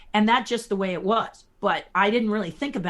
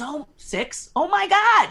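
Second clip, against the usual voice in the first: amazed; 10 semitones higher, 6 dB louder, slower than usual. Retold or imitated. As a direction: voice jumps up high and loud in mock amazement. Jim quoted reacting to her age.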